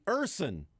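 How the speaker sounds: Urson said slowly with heavy weight; slow exaggerated Urson; stress on Urson. Shown as a demonstration. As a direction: punch the word Urson, slowly. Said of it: "that was really the emphasis".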